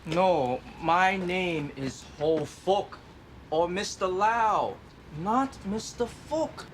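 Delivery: slow, deliberate name correction. Real, not acted. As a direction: spell the name out slowly. Correcting his name slowly.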